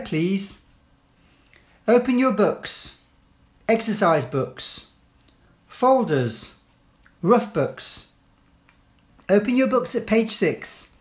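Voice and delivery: firm, clear classroom instructions; teacher giving commands. Shown as a demonstration. Teacher to pupils entering class, firm clear commands.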